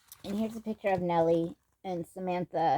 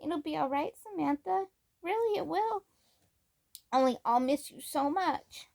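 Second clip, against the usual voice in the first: put-on much higher, tearful little-girl voice; 9 semitones higher than usual. Nellie comforts Samantha while crying, much higher tearful voice. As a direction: brave through tears. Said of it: "Nellie had started to cry".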